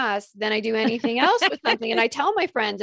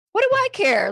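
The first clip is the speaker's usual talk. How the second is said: higher, louder, brushing it off; mock exasperation; dismissive; 11 semitones higher, 6 dB louder than usual. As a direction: scoff at the idea. Dismissing friend's worry.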